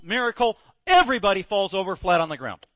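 ordinary talk

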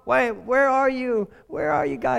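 high, loud, slow pleading call to God; anxious cry to God. Retold or imitated. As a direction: cry out to God like something's badly wrong. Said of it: "I'm like".

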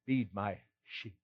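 quiet weighty charge; solemn and soft. Acted. Jesus's solemn commission.

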